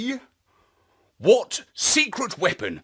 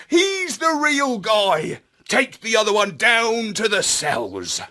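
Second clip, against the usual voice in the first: Astropup ordering; 6 semitones higher than usual. A narrator's reading. Astropup ordering the robo-dogs, higher and firm.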